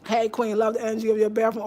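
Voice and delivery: usual speaking voice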